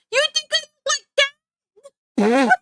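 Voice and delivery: high squeaky mock outrage; playful high-pitched indignation; mock offended squeal. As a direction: squeal it in fake disbelief. Mock offended at alpha drawing.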